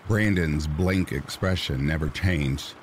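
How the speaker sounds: ordinary delivery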